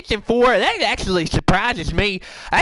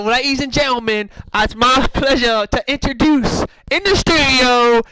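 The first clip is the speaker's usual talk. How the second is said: announcer introduction; 4 semitones higher, 4 dB louder than usual. Pretend. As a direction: stretch the name like a ring announcer. Introducing the next host.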